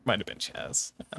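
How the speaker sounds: whispered, echoing the name; whispered aside. Real, not acted. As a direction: breathe it back under your breath. Repeating the name quietly.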